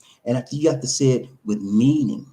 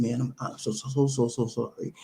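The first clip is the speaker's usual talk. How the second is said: soft, low, stammered apology; remorseful apology; 4 semitones lower, 7 dB quieter than usual. Acted. Man apologizing to someone he wronged, softly remorseful. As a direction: quietly stumble into a heartfelt apology. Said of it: "I had feelings of remorse".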